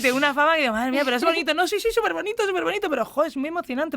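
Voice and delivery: high, loud and gushing; real thrill bubbling up as she talks; excited. Real, not acted. Excited about her tearful fame.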